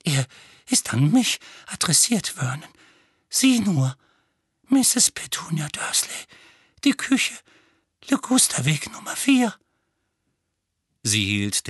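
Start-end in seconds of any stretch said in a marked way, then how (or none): 0.0-9.5 high, trembling, frantic plea; panicked frantic pleading. Acted. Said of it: "mit zitternder Stimme".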